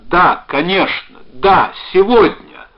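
high, loud, slow assent; emphatic eager yes; eager readiness. A narrator's reading. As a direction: agree wholeheartedly, rushing to meet them. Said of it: "со всегдашней своей готовностью".

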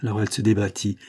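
plain reading voice

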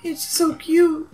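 slow, high gush over cuteness; stretched-out, high-pitched coo; cooing adoration. Real, not acted. Gushing over a baby elephant.

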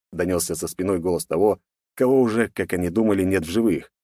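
usual speaking voice

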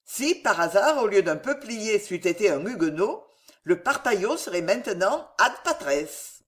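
plain reading voice

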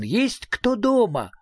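mild hail at a closed door; calling out. A narrator's reading. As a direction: ask slowly into the house, voice lifted. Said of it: "крикнул Фредриксон".

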